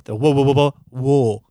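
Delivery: "wo" repeated with a clear w glide at the start; wrong wo sound. Shown as a demonstration. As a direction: keep repeating "wo", leaning on the w. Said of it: "o, not wo".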